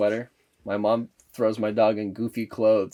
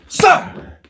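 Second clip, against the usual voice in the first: loyal soldier cry; 9 dB louder than usual. Retold or imitated. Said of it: "He's like".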